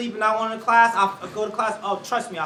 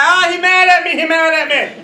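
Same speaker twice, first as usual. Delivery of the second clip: louder, higher mock panic; mock alarm; 4 semitones higher, 8 dB louder than usual. People reacting to an intense speaker, mock alarm. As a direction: act scared of the angry man. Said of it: "be like".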